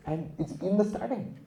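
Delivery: ordinary talk, nothing marked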